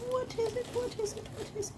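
high-pitched repeated excited question; excited squeal. Real, not acted. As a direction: squeal it out eagerly.

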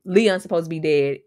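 usual speaking voice

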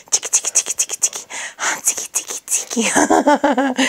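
soft breathy ticky ticky; whispered tickling. Real, not acted. Owner tickling the puppy, soft whispered ticky ticky.

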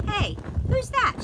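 put-on high loud girl; high surprised girl; Joy's voice. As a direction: pop up and call out high. Joy popping up, high.

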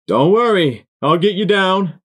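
high, loud shout across a distance; calling down. A narrator's reading. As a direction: yell reassurance down from a ledge. Buck calls down from the ledge.

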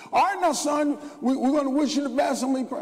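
a hint of a formal parent's farewell; imitating his father. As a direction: play the dad seeing his son off. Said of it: "Nobody hugged me, kissed me".

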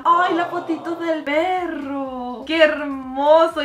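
high, slow loving coo; cooing delight. Real, not acted. Seeing the miniature dog photo, slow high coo. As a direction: coo over the tiny picture.

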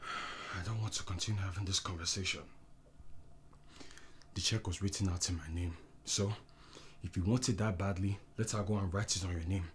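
very low, subdued, controlled voice; cold withdrawal. Acted. Husband ending quarrel with wife, cold and low. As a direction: shut the conversation down quietly. Husband coldly ends the argument.

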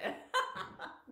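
voiceless chuckle; breathy laugh. Real, not acted. Laughs while editing talk.

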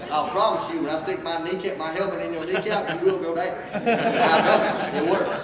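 laughing boast. Real, not acted. Boasting to the crowd about tackles, laughing loud. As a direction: chuckle while you promise it works.

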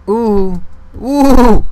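high, loud rising ooh; alarmed ooh. Real, not acted. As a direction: yelp as the mobs close in. Under attack in game.

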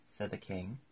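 plain reading voice

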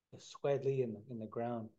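plain reading voice

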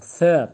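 "S" said loud with the tongue lightly touching the upper front teeth; lisped S. Shown as a demonstration. Teacher shows students the lisped S, said loud. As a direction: lisp the "S", tongue tip under the top teeth. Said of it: "Dilimizi hafiften S. Ön üstlerin altına değdiriyoruz".

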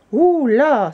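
high, loud, drawn-out alarm; taken-aback outcry; exclaiming. A narrator's reading. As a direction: blurt a startled objection. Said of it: "s'exclama le grand Père Noël".

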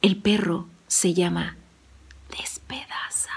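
nombre susurrado, lento; susúrralo al oído; susurro al oído. A narrator's reading. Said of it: "Se acercó al oído de Juan". (el viajero susurra el nombre a Juan, en susurro)

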